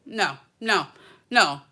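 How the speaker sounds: usual speaking voice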